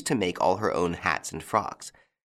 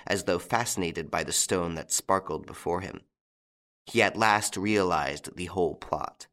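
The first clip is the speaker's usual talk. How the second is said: ordinary delivery, nothing marked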